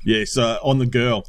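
ordinary talk

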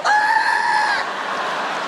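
very high, loud scream of rage; furious scream. Acted. Driver pulling off furious, high scream. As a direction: scream it out in frustration.

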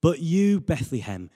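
normal talking voice

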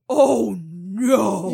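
withering, deflated groan; withered. Acted. Man whose bluff was called, withering. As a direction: let the bravado wilt away. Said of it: "he just withered and was like".

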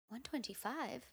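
very soft, questioning re-enactment; hushed disbelief. Retold or imitated. Stepping on parents' scale, hushed disbelief. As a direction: say it under your breath, as if doubting the number.

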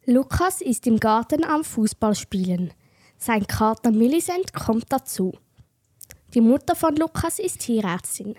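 normal talking voice

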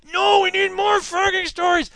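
high, strained mock protest; mock heckler. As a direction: put on a high-pitched heckler's wail.

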